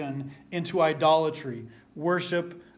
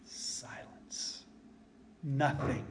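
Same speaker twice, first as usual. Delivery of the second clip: hushed; 3 semitones lower, 12 dB quieter, much slower than usual. Acted. Dramatic pause, nothing happens.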